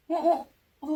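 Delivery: confused rising huh sounds; puzzled alarm; startled. Retold or imitated. Noticing rope falling.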